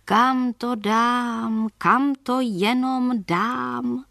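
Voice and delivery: a lost soul pleading into the night, very slowly; desperate ghost. Acted. Restless ghost near the cemetery, desperate slow wail. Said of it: "blíž a zoufaleji".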